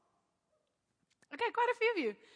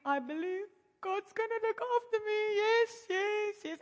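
higher, pleading affirmation of faith; high-pitched declaration; 8 semitones higher than usual. Retold or imitated. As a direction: talk yourself into believing. Fighting tears, declaring belief.

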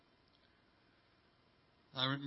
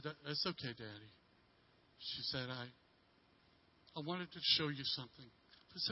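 softer, small disappointed voice; hurt little girl; 7 dB quieter than usual. Retold or imitated. Little daughter, brushed off by father, softly disappointed. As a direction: let it fade as if giving up.

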